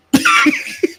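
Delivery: very high, short giggle fit; squealing laugh. Real, not acted. Daughter cracking up mid-story about mom, squealing.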